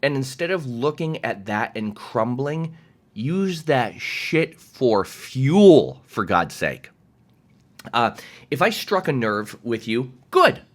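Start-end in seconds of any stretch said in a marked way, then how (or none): 3.1-6.0 slow, loud, drawn-out push; emphatic urging. Real, not acted. Coach pushing viewers to use envy, emphatic. 10.3-10.6 high, loud burst; emphatic exclamation. Real, not acted. Coach glad to have struck a nerve, emphatic.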